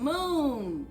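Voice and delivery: plain reading voice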